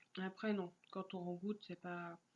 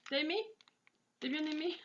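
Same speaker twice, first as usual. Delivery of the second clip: playful laughing; 6 semitones higher, 4 dB louder than usual. Real, not acted. Teasing the pet, then laughing.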